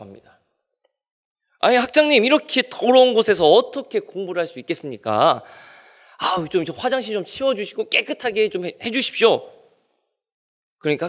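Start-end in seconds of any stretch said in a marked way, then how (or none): 1.6-9.5 complaining student. Retold or imitated.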